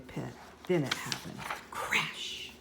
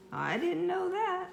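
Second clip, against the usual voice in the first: surprised; 14 semitones higher than usual. Acted. Surprised boy.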